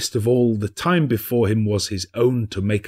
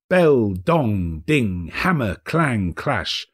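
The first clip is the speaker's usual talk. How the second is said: usual speaking voice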